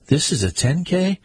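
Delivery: "This is a ten K?" pitched high and rising on "K" in disbelief; incredulous question. Acted. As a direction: ask it as if you can't believe the distance. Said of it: "he asked".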